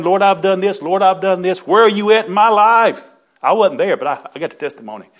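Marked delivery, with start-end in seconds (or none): 0.0-3.1 louder, higher pleading cry to the Lord; a retold anguished outcry, raised and pitched up. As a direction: reenact the wife's desperate plea. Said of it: "crying out, she was complaining".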